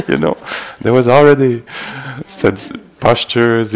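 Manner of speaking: loud, amused, words riding on a laugh; talking through laughter. Real, not acted. Teacher reassuring meditators they managed already, laughing as he talks. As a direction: keep talking as the laugh spills over. Still laughing after his joke.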